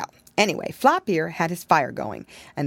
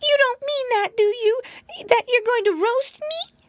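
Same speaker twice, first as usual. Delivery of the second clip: frightened piglet; 3 dB louder than usual. Acted. Terrified piggy pleading.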